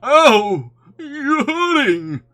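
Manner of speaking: high, loud, drawn-out howl; pained cry. A narrator's reading. Rabbit pulls Pooh's paw, a high loud cry of pain. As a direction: yell as if your paw is being yanked.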